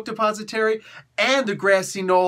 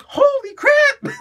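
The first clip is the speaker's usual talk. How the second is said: much higher, loud, drawn-out holy crap; shocked shriek; 10 dB louder, much slower than usual. Retold or imitated. Reader reacting to mass character deaths, shrieking. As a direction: shriek it out in disbelief. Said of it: "I'm like".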